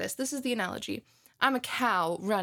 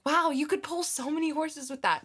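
high-pitched impressed gushing; higher voice, wowed; mock awe; 7 semitones higher than usual. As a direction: gush over a truck in exaggerated admiration. Acts admiring a truck.

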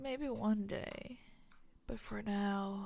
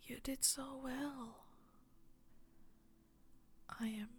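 put-on close warm intimate boyfriend; 9 dB quieter than usual. Tender aftercare after the scene.

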